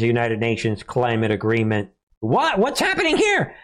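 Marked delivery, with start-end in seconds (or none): 2.2-3.5 high-pitched, incredulous outburst; mock astonishment. Host reacts to Saudi praise of climate agreement, mock astonished. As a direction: react as if utterly baffled, pitched way up.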